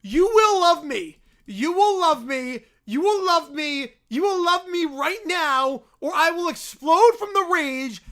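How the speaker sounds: slow, loud raging bear demands; hammed-up furious bear. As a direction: bellow each demand as he charges. Raging bear charges, story read.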